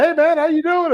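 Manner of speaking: high, eager hello to a star angler; light up like meeting your hero; star-struck fan saying hi; excited greeting. Retold or imitated. Greeting his fishing idol excitedly.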